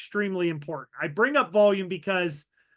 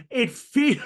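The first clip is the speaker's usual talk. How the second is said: laughing; 5 semitones higher, 5 dB louder than usual. Real, not acted.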